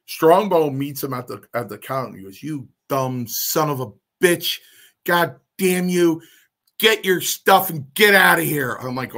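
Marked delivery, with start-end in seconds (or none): 2.4-8.7 slow, rising angry tirade, louder and higher; furious boss. Retold or imitated. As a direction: tear into the kid. Strongbow furious at the kid.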